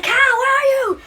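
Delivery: high, loud call across a wide area. Retold or imitated. Player calling out for missing teammate Cal, loud searching call. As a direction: shout his name to find him.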